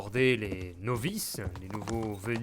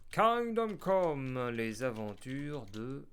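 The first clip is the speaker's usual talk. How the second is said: mock title announcement; 4 dB quieter, much slower than usual. Announcing the game's episode title.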